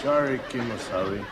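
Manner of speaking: low, monotone stock Indian voice; low, stilted stage Indian speech; mock stage Indian sidekick. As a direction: play the subservient Indian sidekick, low and level. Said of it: "I'm tired of talking like an Indian".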